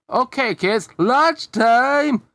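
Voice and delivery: put-on high, loud sing-out to the kids; teacher calling out. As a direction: call the children in from the playground. Teacher calls children in for lunch.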